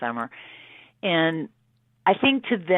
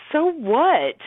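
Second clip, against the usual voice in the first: higher-pitched, shrugging-off "so what"; defiant retort; 10 semitones higher, 4 dB louder than usual. Retold or imitated. Singer answering the fuss about her performance, defiant. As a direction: toss it back, pitched up.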